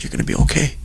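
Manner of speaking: soft, whispery retold voice; hushed reassurance. Retelling the voice he heard before the test, hushed reassurance. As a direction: barely voice it, as a comforting message.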